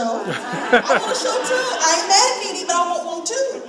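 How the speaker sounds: loud, high, laughing "I want one too"; laughing plea. Real, not acted. Performer joking to the crowd about wanting her own show, loud and laughing. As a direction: laugh it out as a playful demand.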